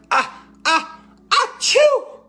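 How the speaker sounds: high, loud building sneeze; big sneeze. A narrator's reading. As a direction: build up then burst the achoo. Elephant sneezes.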